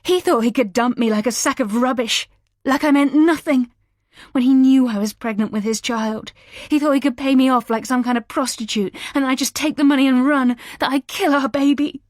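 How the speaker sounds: high, loud furious rant. A narrator's reading. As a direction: rant with rage, out of control. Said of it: "all control now lost".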